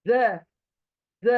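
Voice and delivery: ordinary talk, nothing marked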